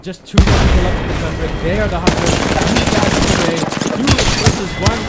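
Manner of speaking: rising hype, calling the fight loud; excited play-by-play. Real, not acted.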